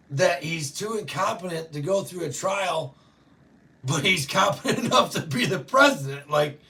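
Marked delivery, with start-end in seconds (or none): none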